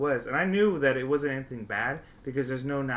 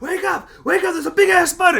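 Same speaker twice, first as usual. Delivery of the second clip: much higher and louder panicked shouting; panicked yell; 12 dB louder than usual. Retold or imitated. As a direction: yell the alarm about a spider. Reenacted waking roommates.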